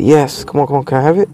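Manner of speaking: normal talking voice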